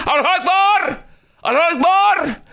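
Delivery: high-pitched imitation battle cry; mock jihadi cry. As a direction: cry it out high, drawing out the last syllable.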